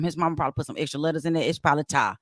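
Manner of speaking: normal talking voice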